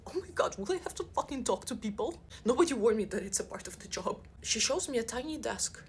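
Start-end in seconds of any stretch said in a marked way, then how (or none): 0.1-2.2 a touch of altered panicky voice; mock-panic about having to talk; panicked inner voice. Newbie seeing the open space, mock-panicked. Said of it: "I'm like".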